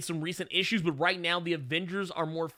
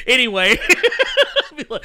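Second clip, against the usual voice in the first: ordinary talk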